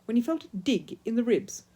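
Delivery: plain reading voice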